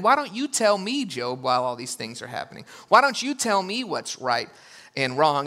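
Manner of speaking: God's sarcastic challenge to Job. Retold or imitated. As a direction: needle him like a know-it-all rival. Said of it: "he gets sarcastic with him".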